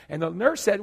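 ordinary delivery

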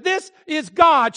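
high, loud, slow announcement; excited retelling of God speaking. Retold or imitated. Retells his answer as God.

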